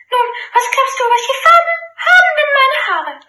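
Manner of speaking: high, impatient princess. Acted. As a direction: demand it impatiently. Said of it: "fragt diesen voller Ungeduld".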